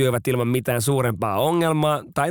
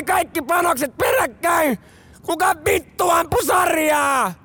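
angry shout. Retold or imitated. First lieutenant yelling at conscripts over the burst, angry shout. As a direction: demand an answer from the whole line. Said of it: "Yliluutnantti huutaa".

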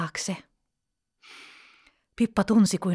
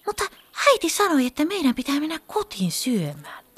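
higher, mildly sulky telling; vexed child's complaint; 7 semitones higher, faster than usual. A narrator's reading. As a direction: complain a little, like a let-down child. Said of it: "Pippa kertoi harmissaan".

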